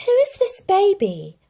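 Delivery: a high, loud, puzzled little dog voice; wondering puppy. Acted. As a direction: wonder aloud as a young puppy. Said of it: "wondered Lady".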